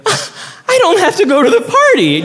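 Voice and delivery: giddy relief. Retold or imitated. To his husband, laughing high relieved squeal. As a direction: squeal with relief at being let off.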